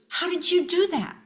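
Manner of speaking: high, startled asking of the stranger; startled question. Retold or imitated. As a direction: sound as if you just got caught out. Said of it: "And she goes".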